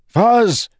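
desperate high shout; a frantic cry for a friend; scream. Acted. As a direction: scream the name into the sky. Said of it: "he screamed".

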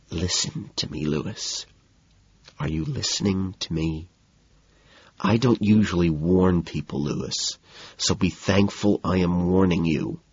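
very soft, menacing warning; whisper under breath. A narrator's reading. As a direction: threaten him in a hushed voice. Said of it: "I whisper under my breath".

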